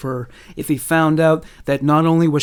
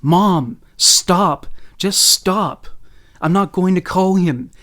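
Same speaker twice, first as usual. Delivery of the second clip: a daughter at breaking point, crying out; screaming at mom; 4 semitones higher, slower than usual. Acted. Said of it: "all she could do was scream".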